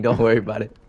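ordinary talk, nothing marked

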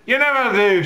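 very high, loud wifely accusation; loud, high-pitched mock wife scolding; nagging wife. Wife contradicting her husband, high loud nagging. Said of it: "she says".

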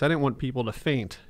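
ordinary talk

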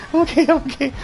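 ordinary talk, nothing marked

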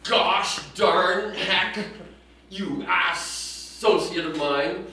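loud, drawn-out mild cursing; hammed-up fury, each word slow and loud; mock furious. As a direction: burst out in mock outrage. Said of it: "I got furious".

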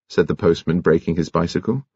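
plain reading voice

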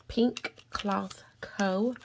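slow and careful, each word spaced out; said slowly. Shown as a demonstration. Vlogger to viewers, naming the shop, slow and deliberate. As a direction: take the brand name nice and slow so you don't trip. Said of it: "I have to say it slow".

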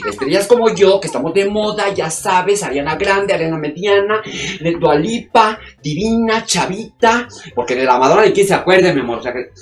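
brash, loud, fast-talking show-off starlet; loud diva. Acted. Vain actress on the phone to her agent, loud diva gush. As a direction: gush and snap like a vain celebrity.

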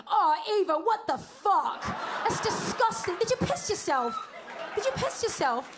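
high, appalled British castmate; British accent. Retold or imitated. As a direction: exclaim in disgust with a British accent. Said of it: "he's British".

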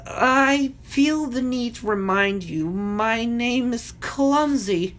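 high, slow, drawn-out character voice; stretched-out high plea while being carried away; nervous wail. Acted. Clumsy wailing as he is flown off.

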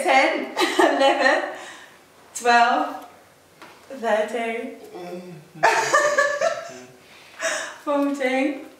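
counting through laughter; giggling between numbers; laughing. Real, not acted.

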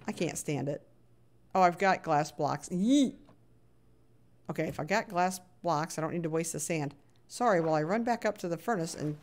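ordinary talk, nothing marked

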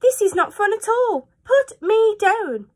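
high, loud squealing fish; high panicky fish plea. Acted. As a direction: squeal to be let down. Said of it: "said the fish".

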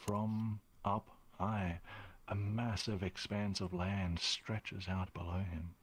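measured storytelling with long pauses; game master narration. Acted. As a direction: narrate a vision phrase by phrase.

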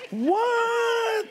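high laughing "What?"; surprised shout. Real, not acted. Preacher reacts to the crowd with a high, laughing shout.

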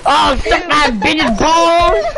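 very slow hillbilly drawl. Pretend. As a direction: drag it out like a hillbilly. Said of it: "you sound like a hillbilly".

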